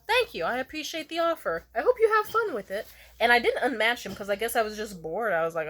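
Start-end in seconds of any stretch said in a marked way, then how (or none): 0.1-2.9 high, singsong mock politeness; gracious decline. Thanking him for the coke offer, high and gracious. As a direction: decline sweetly, pitched up.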